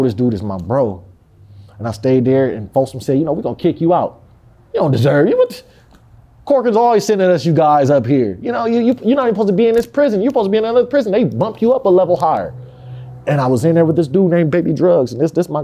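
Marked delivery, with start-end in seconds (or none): 3.2-12.5 high, quick mock official voice; guard voice lightly mocked, raised pitch; mocking prison official. Folsom officials quoted.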